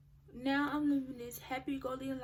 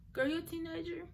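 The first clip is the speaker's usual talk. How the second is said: higher-pitched self-encouragement; retold pep talk; 6 semitones higher, 3 dB louder, faster than usual. Speaker reassuring herself as a teenager, encouraging. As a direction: talk yourself round, pitched up.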